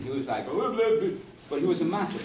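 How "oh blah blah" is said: put-on a quick, light send-up of a Russian accent, pitched higher. Author imitating Nabokov's Russian accent for the audience. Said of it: "a great Russian accent".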